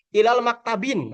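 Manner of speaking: ILAL MAKTABIN with wrongly added final n; mistaken tanwin reading. Retold or imitated. Teacher imitating someone who hasn't studied, mistaken -bin ending. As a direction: link "ilal", then end "maktabin" in -bin. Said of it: "berarti belum belajar".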